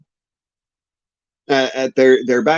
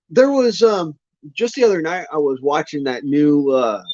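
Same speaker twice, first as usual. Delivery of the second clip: ordinary talk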